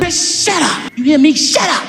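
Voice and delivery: high, sharp mock reprimand; scolding mother. Mother shushing son in church, high mock scolding. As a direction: imitate a fed-up mother raising her pitch.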